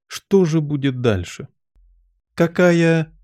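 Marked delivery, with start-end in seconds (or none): 2.3-3.2 higher, hesitant small talk; timid. A narrator's reading. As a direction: venture it nervously, stumbling at first. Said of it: "робко произнёс кто-то".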